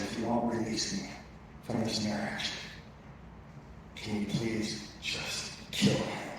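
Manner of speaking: slow, whispered plea; soft, slow whisper of despair; hushed desperate prayer. Retold or imitated. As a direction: breathe it out like a secret prayer. Wife's desperate prayer retold.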